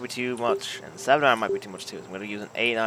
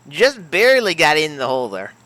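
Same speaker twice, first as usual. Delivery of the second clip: excited; 7 semitones higher, 8 dB louder than usual. Real, not acted. Putt drops in.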